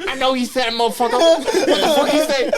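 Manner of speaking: high, loud laughing sergeant yell; angry drill sergeant. Retold or imitated. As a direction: shake the recruit while yelling, laughing through it. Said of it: "that I could impersonate pretty well".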